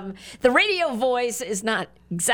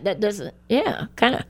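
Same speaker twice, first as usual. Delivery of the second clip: ordinary talk, nothing marked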